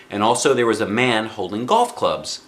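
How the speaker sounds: ordinary delivery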